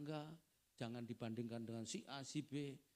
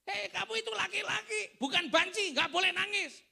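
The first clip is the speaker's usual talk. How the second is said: loud, high mock shout of an angry parent; hammed-up furious scolding; 16 dB louder than usual. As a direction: act out a parent yelling at a crying child. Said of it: "Kelirunya orang tua kan begitu".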